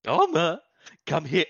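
loud, call the pet over. Acted. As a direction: call out across the room. Calling the dog.

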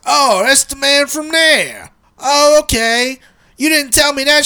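high, drawn-out mock holler; imitated shouting. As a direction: mimic a husband loudly making his point, stretching the words. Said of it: "they start shouting at each other".

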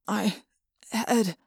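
weak voice. A narrator's reading. Jake barely able to speak.

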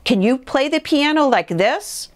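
high, boastful storybook question; lightly voiced proud toad; Toad crying out. A narrator's reading. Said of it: "cried Toad".

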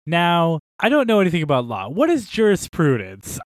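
hammed-up naive knight voice; mock clueless Fitzroy. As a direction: play the earnest hero who knows nothing about law. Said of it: "where he's like".

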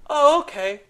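high, loud surprised reply; startled oh okay. Retold or imitated. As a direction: blurt it out caught off guard. Reacting to awkward reveal.

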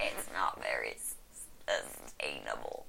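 very low whispery croak; inhaled croak voice. Acted. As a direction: croak while breathing in. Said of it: "speaking on the intake of breath".

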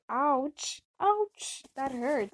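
high, loud mock yelp of pain; hammed-up wounded reaction; hurt exclamation. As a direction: cry out as if stung. Reacting to a hurtful comeback.

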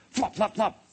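three high, quick-popped effects; flying hat sounds. Acted. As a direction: pop out a run of flaps. Imitates hats flying off.

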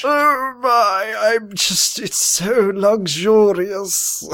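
high, drawn-out British swoon; hammed-up British luxuriating; posh British put-on. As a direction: stretch the last word in mock-British bliss. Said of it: "it made me British".

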